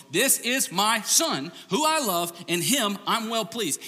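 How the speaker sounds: high, loud, slow declaration; the Father speaking over his son; booming God voice. Retold or imitated. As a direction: proclaim it from heaven. God's proclamation retold.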